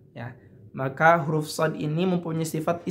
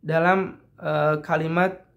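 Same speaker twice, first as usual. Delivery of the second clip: ordinary talk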